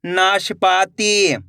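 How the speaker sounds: slow, stretched reading of the whole word; joined-up word. Shown as a demonstration. Teacher showing learners the finished word, slowly joined up. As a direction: blend the syllables into one drawn-out word. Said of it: "तो मिलके बन जाएगा".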